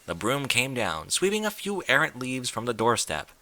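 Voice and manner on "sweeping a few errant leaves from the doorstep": usual speaking voice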